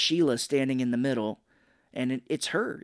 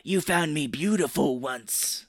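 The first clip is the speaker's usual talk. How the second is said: slow, drawn-out mock villainess line; Sheila; slower than usual. Sheila to Ash, famous line, slow drawn-out voice. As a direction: imitate a wounded monster lover, drawing it out. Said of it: "where she's like".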